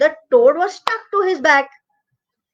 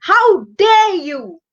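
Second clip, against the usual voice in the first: higher, slow, indignant crocodile; drawn-out how dare you; angry crocodile; 5 semitones higher than usual. Acted. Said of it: "So he got so angry".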